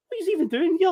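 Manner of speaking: high-pitched baffled question; imitating incredulity. People asking about the Scottish fan, mock incredulous. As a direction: mimic puzzled onlookers, voice jumping up.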